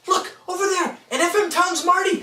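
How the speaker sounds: excited. Acted. Excited kid spotting a console.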